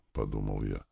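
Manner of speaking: plain reading voice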